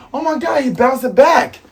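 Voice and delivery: high-pitched stunned exclamation; mock shock. Voices the amazed crowd.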